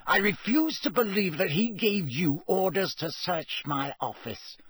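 tight, outraged protest; through clenched teeth. A narrator's reading. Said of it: "said Snape through clenched teeth".

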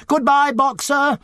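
high, drawn-out cheery call; chorused farewell call. A narrator's reading. Crowd of animals seeing Boxer off, chorused call. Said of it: "they chorused".

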